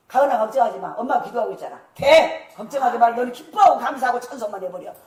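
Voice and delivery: loud, confident mother's proclamation; firm loud assurance, no doubt in it; bold declaration. Retold or imitated. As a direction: declare it boldly to the child. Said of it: "막 이러고 막 선포해".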